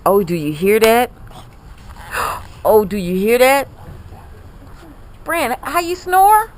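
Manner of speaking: usual speaking voice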